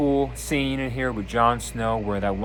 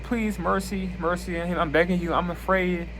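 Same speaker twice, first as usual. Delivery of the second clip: begging; 8 semitones higher than usual. Retold or imitated. Condemned man begs Jon Snow.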